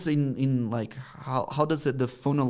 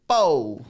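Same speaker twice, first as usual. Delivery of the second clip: punchy, higher and louder "pau"; comic book impact sound; 6 semitones higher, 7 dB louder than usual. Retold or imitated. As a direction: pop it out like a comic sound effect. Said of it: "in Portuguese it would say".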